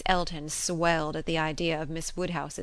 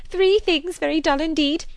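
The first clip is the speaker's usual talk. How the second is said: very high, loud, delighted echo; Miss Bates; 12 semitones higher, 11 dB louder than usual. A narrator's reading. Spinster echoing the game's rule, high exclamation. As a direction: seize on it in an excited high voice. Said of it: "exclaimed Miss Bates".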